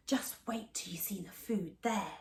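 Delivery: whispered secret. Acted. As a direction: whisper the treat. Secret about dining room.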